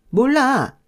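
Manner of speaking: an indignant shout refusing to listen; defiant outburst. Acted. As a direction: spit it out like a stubborn child. Sister-in-law refuses stubbornly.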